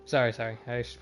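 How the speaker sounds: normal talking voice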